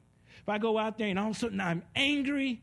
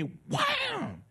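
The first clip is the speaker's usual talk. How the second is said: slap sound effect. Acted. Imitating a slap.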